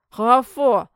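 loud muffled through pancakes; mouth full. Acted. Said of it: "пробормотал Клеон с набитым ртом".

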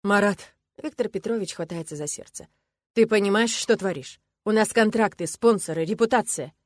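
the coach scolds Marat on live air; angry coach. Acted. Loud indignant coach.

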